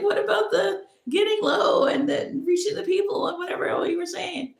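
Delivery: high, loud questioning; bewildered demand; incredulous. Retold or imitated. Asking him about the earlier instructions, high bewildered. Retold disbelief.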